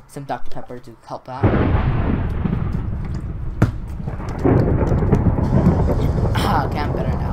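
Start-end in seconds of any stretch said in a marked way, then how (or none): 1.5-6.4 long, loud throat clear. Real, not acted. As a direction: keep hacking to clear the throat. Clearing a failing voice.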